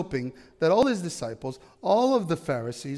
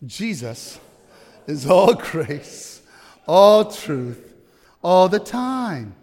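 slow, drawn out and louder toward the end; rallying group recitation; 6 dB louder, much slower than usual. Shown as a demonstration. Preacher getting the men to join in, emphatic recitation. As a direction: lead the crowd in a building chant. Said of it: "now men too, please".

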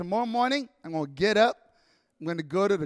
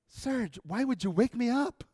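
softer, sleepy wife's complaint; groggy annoyed wife; 3 semitones higher, 4 dB quieter than usual. Retold or imitated. Wife woken at midnight by husband, groggy and annoyed.

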